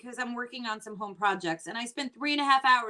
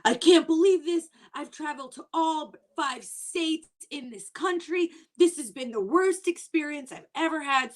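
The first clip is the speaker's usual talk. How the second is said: high, loud hammed-up meltdown; imitating the upset guest, pitched up and loud; mock distraught guest; 5 semitones higher, 4 dB louder than usual. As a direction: whine it like a traveler whose day is ruined. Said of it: "She was like".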